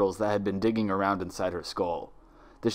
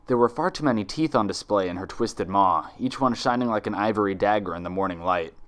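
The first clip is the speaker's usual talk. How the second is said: ordinary delivery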